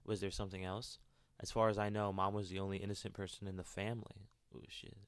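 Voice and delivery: steady storytelling read; story narration. Acted. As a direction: narrate the character's thoughts evenly. Reads game character's inner monologue.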